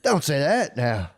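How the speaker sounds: high, slow Aussie scold; Australian accent. Retold or imitated. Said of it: "Is that your Australian accent?".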